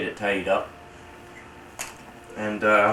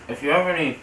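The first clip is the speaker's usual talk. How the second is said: talking through a bite; chewing while talking; 8 semitones higher, 4 dB louder than usual. Real, not acted. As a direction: mouth full of food. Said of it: "excuse me, I'm chewing here".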